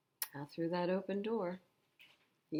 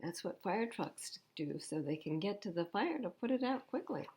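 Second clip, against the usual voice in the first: plain reading voice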